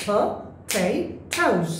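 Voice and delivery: potatoes with the TA in the middle stretched out very slowly; stress on middle syllable. Shown as a demonstration. Teacher dwelling on potatoes' middle syllable for students, very slow. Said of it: "এই ঠেইটা আমরা জোরে বলবো".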